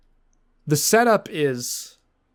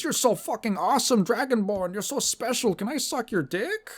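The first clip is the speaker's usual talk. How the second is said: fawning NPC; 8 semitones higher than usual. Retold or imitated. Admirer swooning over the Dragonborn, high and mock-adoring. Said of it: "the person who goes".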